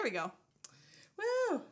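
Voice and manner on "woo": excited. Real, not acted. Cheers once the sound is fixed.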